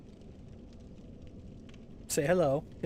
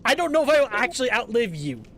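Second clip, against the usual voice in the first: playful exclamation; 6 semitones higher, 7 dB louder than usual. Real, not acted. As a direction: exclaim in mock outrage. Playful banter.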